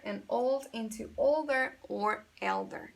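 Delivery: slow, careful English explanation, x0.6; English teaching voice. Acted. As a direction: spell out the irregular forms slowly for the class. Switches to English teaching mode.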